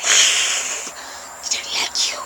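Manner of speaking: slow breathy whisper. Pretend. Animal act to someone she dislikes, slow whisper. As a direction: whisper it slowly. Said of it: "and I'm like".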